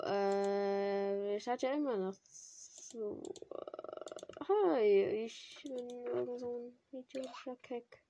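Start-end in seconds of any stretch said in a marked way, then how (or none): none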